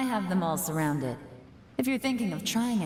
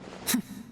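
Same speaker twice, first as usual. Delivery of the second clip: short breathy scoff; scoffing laugh; 4 dB quieter than usual. Acted. Investigator mocking the suspect's promise, short scoff.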